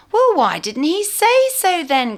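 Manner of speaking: slow, high and loud grumble; grumbling. A narrator's reading. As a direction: complain indignantly, a mock huff. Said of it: "grumbled Saucepan".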